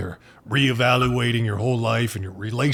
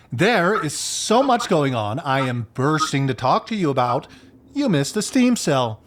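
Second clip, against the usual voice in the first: ordinary delivery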